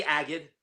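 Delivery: "Agid" with a hard g, stress up front; correct name pronunciation. Shown as a demonstration. Guest tells host how his surname goes, correct pronunciation. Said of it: "not really sure how to pronounce your name".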